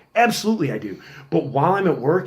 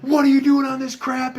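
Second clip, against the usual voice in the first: much higher, scolding shout; angry scolding; 12 semitones higher, 3 dB louder than usual. Retold or imitated. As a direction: tear into the kid for using. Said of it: "I start lighting into this kid".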